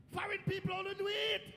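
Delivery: high, loud exclamation; incredulous shout. Retold or imitated. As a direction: cry it out in mock outrage. Shouted complaint about the cold.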